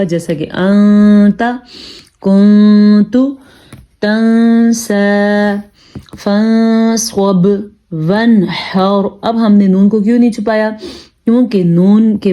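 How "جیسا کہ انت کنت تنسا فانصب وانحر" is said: slow drawn-out tajweed examples; tajweed demonstration; Quranic recitation. Shown as a demonstration. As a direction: stretch each word like a Quran teacher. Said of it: "وہاں پہ خا ہوگا".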